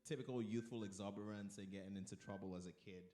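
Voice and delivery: ordinary talk, nothing marked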